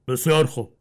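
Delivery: plain reading voice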